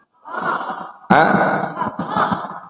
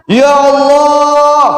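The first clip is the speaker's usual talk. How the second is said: drawn-out wailing cry of Ya Allah; shouting Ya Allah; 9 semitones higher, 4 dB louder, much slower than usual. Retold or imitated. Husband crying out to God on his deathbed, long wailing shout. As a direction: wail it long and high like a dying man. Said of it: "bengok-bengok ngomong ngene".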